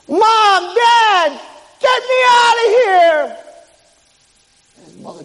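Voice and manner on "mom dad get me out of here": high, loud cry to parents; desperate shout. Acted. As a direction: yell to mom and dad for rescue. Son calls to parents for rescue.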